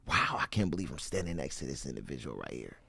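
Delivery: soft, low starstruck murmur; hushed awe. Acted. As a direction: think it to yourself in wonder. Voices a starstruck inner thought.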